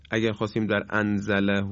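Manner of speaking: ordinary talk, nothing marked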